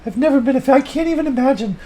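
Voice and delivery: high, loud put-on woman's voice; Sally chiming in on the fish moment, high and loud; Sally's voice. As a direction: answer as Sally in a high falsetto.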